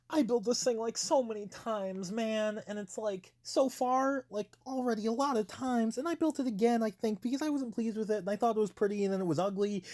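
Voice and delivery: high, whiny complaining voice. Acted. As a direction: whine it out high and loud. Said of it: "in my complaining voice".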